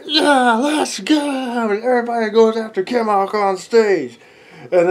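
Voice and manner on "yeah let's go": high loud mob cheer; rowdy whoop. Acted. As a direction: fire up the charge. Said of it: "they're like".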